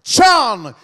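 high, drawn-out ring name callout; arena announcer. Acted. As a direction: stretch the fighter's name for the crowd. Ring announcer calls fighter's name.

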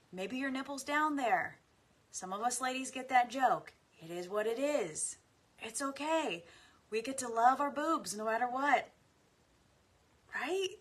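high and loud, amused banter; laughing, joking. Real, not acted. Joking about ladies' bodies.

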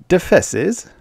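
a high rising puzzled echo of Defesses; the artist asking innocently; feigned ignorance. Acted. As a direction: play dumb asking Defesses?. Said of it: "pretending ignorance".

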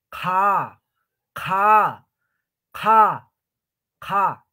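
"Ka" as qha with a puff of air; aspirated Q. Shown as a demonstration. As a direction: blow out through a high "Ka" from the back. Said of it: "como tipo soplando". (qha aspirada, como soplando)

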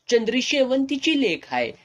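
high-pitched insistent declaration; emphatic assertion. A narrator's reading. Landlord confronts the old man over the woman's parentage, emphatic. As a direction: declare it forcefully, pitched right up.

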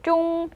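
"쫑" sustained high on the ng; teacher's model "쫑" with a ringing end; demonstrating "쫑". Shown as a demonstration. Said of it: "이렇게 되죠".

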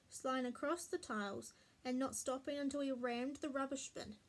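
ordinary delivery

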